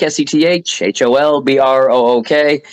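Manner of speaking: S E T H H O L B R O O K, each letter said separately; spelled out letter by letter. Shown as a demonstration. Host spelling his name for the audience, letter by letter. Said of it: "like I said, Seth Holbrook".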